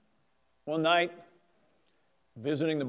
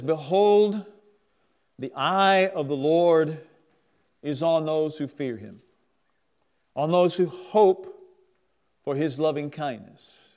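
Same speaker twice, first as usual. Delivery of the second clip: scripture reading; 3 dB louder than usual. Acted. Preacher reads Psalm thirty-three to the congregation, solemnly. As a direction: read the verse with weight.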